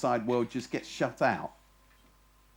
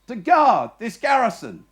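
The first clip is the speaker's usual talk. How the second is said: put-on young guard answering; 8 semitones higher, 7 dB louder than usual.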